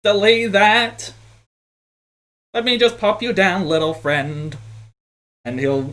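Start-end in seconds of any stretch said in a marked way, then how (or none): 0.0-1.1 high, slow robot buddy voice; squeaky little machine talking. Acted. Robot forklift character voice. 2.5-4.6 a bigger character being gentle. Acted. Character sets down small friend.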